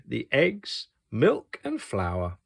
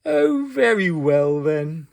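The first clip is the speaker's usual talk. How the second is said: slow, louder sighing give-in; 5 semitones higher, 5 dB louder than usual. Acted. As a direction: give in with a sigh. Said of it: "he sighed".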